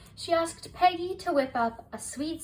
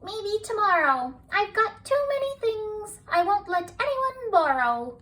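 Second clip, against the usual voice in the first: high, louder, fussy refusal; possessive little girl; 10 semitones higher, 5 dB louder than usual. A narrator's reading. Penny refusing to lend anything, high and fussy. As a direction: clutch your things and say no.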